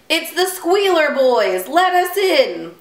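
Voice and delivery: high, urgent little pig voice. Acted. Pigs call to Little Bear through the door, high and urgent.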